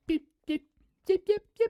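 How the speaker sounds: tiny, high-pitched beeps; high little creature chirps; tiny dinosaur peeps. Retold or imitated. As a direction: squeak like tiny pecking dinosaurs. Imitates tiny pecking dinosaurs.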